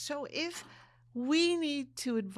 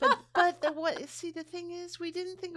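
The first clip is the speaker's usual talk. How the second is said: much higher, louder start; excited; 12 semitones higher, 7 dB louder than usual. Real, not acted. Cutting in to make her point, excited. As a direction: jump in eagerly.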